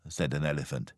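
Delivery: usual speaking voice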